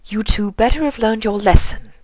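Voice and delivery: high stern scolding; admonishing. Acted. Rarity scolding the couple, stern admonishment. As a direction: warn them like a strict aunt. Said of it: "Rarity admonished".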